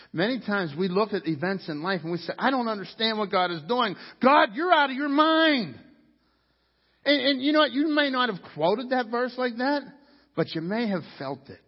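loud, high, fervent sermon climb; preaching at full force. Acted. Pastor preaching hard to his church, fervent.

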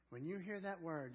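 usual speaking voice

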